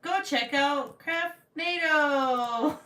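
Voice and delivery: loud, high, drawn-out growly yell; mock-aggressive plug. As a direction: slowly holler a hammed-up command. Mock-aggressive promotion of channel.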